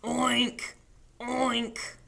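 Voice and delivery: three separate piggy oinks. Pretend. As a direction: oink like a pig, one at a time. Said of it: "He shouted at the howling wind".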